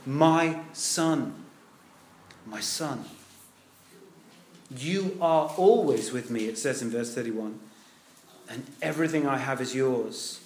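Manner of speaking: soft, low, tender voice of the father. Retold or imitated. Father comforting his elder son, soft and tender. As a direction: speak it gently, like a forgiving father. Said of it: "Would you say they're tender?".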